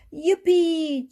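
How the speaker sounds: high, loud cheer of joy; a child's happy cheer. A narrator's reading. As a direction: squeal it with delight. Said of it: "cheered Mandy".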